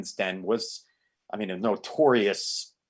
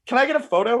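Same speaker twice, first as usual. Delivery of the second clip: eager fan request; 13 semitones higher, 4 dB louder, much faster than usual. Retold or imitated.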